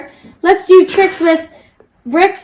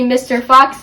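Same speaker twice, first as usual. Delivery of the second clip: ordinary delivery, nothing marked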